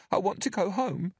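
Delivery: put-on high, small frightened child; timid little girl pleading; scared little girl. A narrator's reading. Narrator voices Bella.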